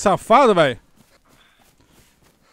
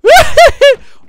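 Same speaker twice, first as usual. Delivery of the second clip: big burst of high laughter; loud laugh; 13 dB louder than usual. Real, not acted. Streamer bursting out laughing at his game. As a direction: crack up loud at the gringo.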